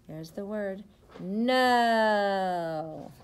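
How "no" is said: loud drawn-out no. Acted. Reader answers whether the truck is full, loud. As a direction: hold the no long and loud.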